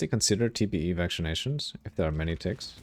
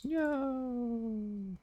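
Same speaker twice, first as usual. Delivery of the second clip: dismayed high no; 7 dB quieter than usual. Real, not acted. Losing cold resistance.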